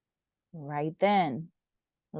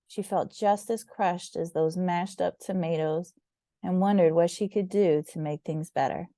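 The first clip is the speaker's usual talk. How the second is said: ordinary delivery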